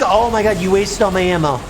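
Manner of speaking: usual speaking voice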